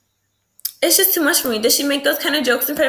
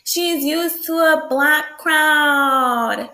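careful correction; 3 semitones higher, 3 dB louder, much slower than usual. Shown as a demonstration. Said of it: "I can't even talk".

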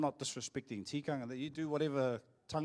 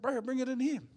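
calling out; 10 semitones higher, 4 dB louder than usual. Retold or imitated. Calling cousin to drive in.

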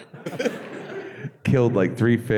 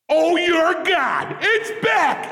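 starstruck shout; much slower than usual. Retold or imitated. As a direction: shriek it like a starstruck fan. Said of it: "I just went".

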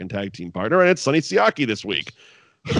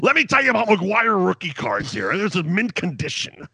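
Don West impression; 7 semitones higher than usual. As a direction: gush like an overexcited card salesman. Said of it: "I'm like Don West right now".

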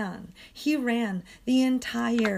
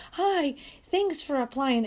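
higher, put-on greeting voice; mock polite reply; 5 semitones higher than usual. Renter mimics reply to housing application, mock polite. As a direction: imitate a form-letter hello in a raised voice.

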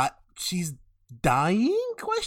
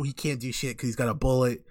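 ordinary delivery

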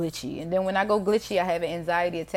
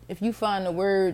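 ordinary delivery

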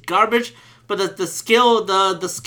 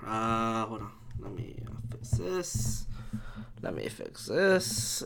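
whisper; 11 semitones lower, 14 dB quieter, slower than usual. Real, not acted. Fixing his mic volume.

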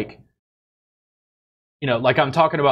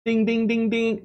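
high sing-song chime, much higher; game show bell; 10 semitones higher than usual. Pretend. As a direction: ring it like a quiz show bell. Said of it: "game-show answer bell".

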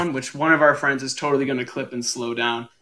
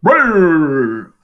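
droid buzz; 2 semitones higher, 5 dB louder than usual. Retold or imitated. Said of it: "I G eighty-eight who goes".

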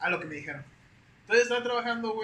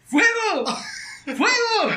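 yelling high and loud in alarm; panicked shout; 13 semitones higher, 11 dB louder, much slower than usual. Retold or imitated. Shouting fire at coworkers in the lab, much higher and louder. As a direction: scream it like the lab is burning. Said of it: "empecé a gritar yo".

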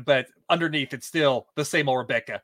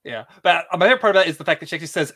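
ordinary talk

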